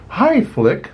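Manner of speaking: put-on high, chirpy little girl voice; high young princess voice; bright Dot greeting. A narrator's reading. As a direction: greet a friend cheerfully, pitched high.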